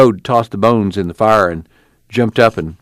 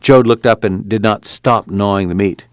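ordinary delivery, nothing marked

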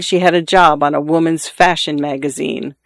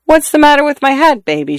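bright, much higher teasing question; playful tipsy tease; 10 semitones higher, 3 dB louder than usual. Acted. Wife home from martinis teases husband, playful and high. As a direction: tease him lightly, a little tipsy.

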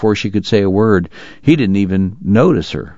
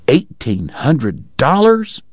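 slow, higher astonished question on dollars; surprised echo; 5 semitones higher, much slower than usual. A narrator's reading. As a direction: echo the sum in disbelief, stretched and rising.

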